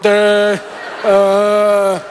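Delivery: dopey, drawn-out stalling noise; dumbstruck. Acted. Dad put on the spot by his son, dumbstruck. Dumbfounded dad when asked to share.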